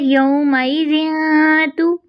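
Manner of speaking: slow recited यौमाइज़िन तु, light ता; Quranic recitation. Shown as a demonstration. Teacher recites the phrase slowly for students. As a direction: chant it slowly. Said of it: "ज़ुबान की जड़ को तालु की जानब नहीं उठाएंगे".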